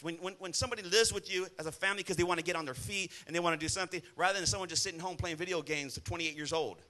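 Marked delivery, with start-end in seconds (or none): none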